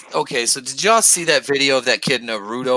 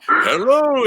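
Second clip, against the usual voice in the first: stomach voice hello; 11 semitones higher than usual. Retold or imitated. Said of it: "He's like".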